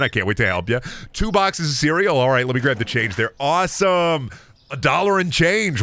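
ordinary talk

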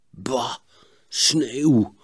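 mildly disgusted grumble; grumbling. Acted. Boy reacting to the coming snow, grumbling. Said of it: "bromde Sietse".

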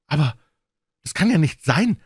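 high, eager woman's voice; young governess, excited and keen. A narrator's reading. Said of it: "bemerkte Fräulein Hunter".

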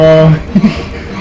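short, delighted laugh; gleeful laugh. Real, not acted. Player escaping the crowd, a gleeful laugh. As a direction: laugh at your own lucky escape.

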